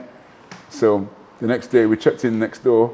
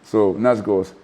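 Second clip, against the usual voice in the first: normal talking voice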